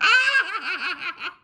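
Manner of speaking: loud high belly laugh; gut laugh. Real, not acted. Vlogger reliving the knockoff Maybach joke, gut laughing. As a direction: let a long high cackle burst out. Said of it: "when I fucking gut laughed".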